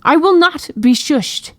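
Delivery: slow, high, indignant cry; enraged. A narrator's reading. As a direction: refuse furiously, drawing out the words. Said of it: "her eyes filled with rage".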